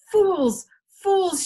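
loud, high cry of exasperation; shouted. A narrator's reading. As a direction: shout it at the whole crowd. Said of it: "shouted Benjamin".

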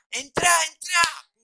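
high-pitched imitated panic; frantic mock urging; urgent beckoning. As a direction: hurry someone through the door. Imitates family urging him inside.

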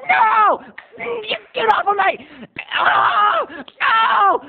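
high hammed-up wailing; protesting cries. Country boy fends off rival, mock wailing. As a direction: yelp as if being shoved away.

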